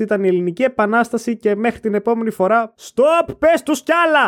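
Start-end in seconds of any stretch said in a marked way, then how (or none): 2.8-4.3 shout. Acted. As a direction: cut in shouting. Outro interruption shout. (φωνάζοντας)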